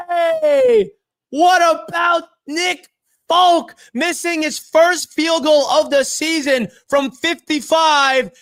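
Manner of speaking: high, shouting and slow; excited celebration. Real, not acted. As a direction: yell in disbelief at the kick. Celebrating the winning kick.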